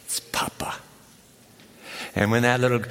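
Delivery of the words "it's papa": whispered softly and fondly; tender whisper. Real, not acted. Grandfather telling his granddaughters' name for him, tender whisper. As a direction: breathe the name like a secret. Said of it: "my name is to them".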